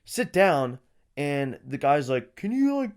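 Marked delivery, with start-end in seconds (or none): 2.4-2.9 high, light put-on photographer voice; mock photographer. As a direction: pitch it up like a fussy photographer. Retelling photographer's request.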